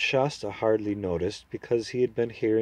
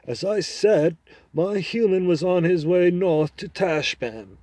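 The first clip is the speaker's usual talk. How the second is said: proud talking horse, higher and louder, persuading; a confident horse making its case to the boy; 6 semitones higher, 7 dB louder than usual. Acted. Horse declares freedom.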